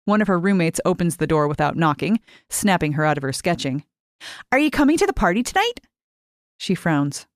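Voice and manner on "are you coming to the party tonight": higher, quick and eager invitation; bubbly roommate talking fast and high. Acted.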